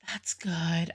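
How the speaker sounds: soft awed whisper. Real, not acted. Reviewer whispering praise as the ballad ends. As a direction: breathe it out, almost to yourself.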